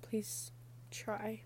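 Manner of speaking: soft, breathy, pleading whisper; whispered plea. Real, not acted. Begging viewers to seek help.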